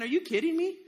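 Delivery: high-pitched protest of disbelief; incredulous. Acted. Gideon facing huge armies with ten thousand men, incredulous. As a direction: blurt it out in disbelief.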